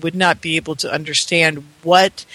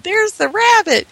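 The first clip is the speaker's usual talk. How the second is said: much higher excited cry; excited kids' shout; 13 semitones higher than usual. Retold or imitated. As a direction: squeal it like a thrilled little sister. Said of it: "My two sisters are screaming".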